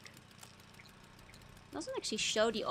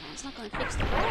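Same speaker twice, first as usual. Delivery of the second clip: normal talking voice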